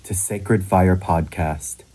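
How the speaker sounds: measured, projected announcer read; podcast intro. Acted. Host invites listeners around a sacred fire, scripted intro read.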